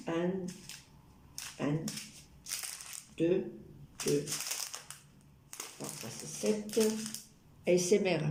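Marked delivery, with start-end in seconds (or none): 0.1-6.8 soft unvoiced whisper close in; ASMR whisper. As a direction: breathe the words right in the ear. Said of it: "je vous préviens, c'est de A S M R".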